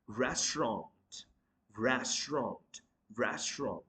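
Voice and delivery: restaurant squeezed to "RES-tront"; correct pronunciation. Shown as a demonstration. Teacher drilling the right form, restaurant as "RES-tront". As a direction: drop the middle vowel of restaurant. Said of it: "the correct pronunciation of this word".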